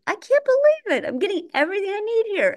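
high, fast gushing delight; giddy shopper voice; excited. Retold or imitated. Mock excited shopper quote.

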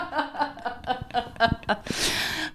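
a quiet breathy-free chuckle up high; soft high laugh. Real, not acted. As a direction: laugh softly to yourself. Laughs at accent joke.

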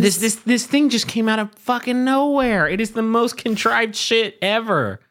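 high-pitched, incredulous outburst; exasperated rant. Real, not acted. Co-host fed up with a contrived feud, exasperated rant.

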